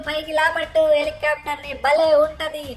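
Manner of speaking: normal talking voice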